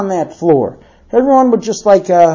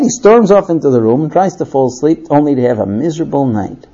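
ordinary delivery

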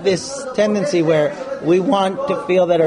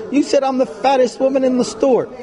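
offended wife; 9 semitones higher than usual. Pretend. Wife accusing husband.

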